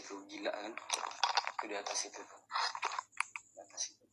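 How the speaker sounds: very soft, barely voiced; hushed fear. Real, not acted. Ghost hunter pointing at the attic, scared whisper. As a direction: whisper so the ghost won't hear.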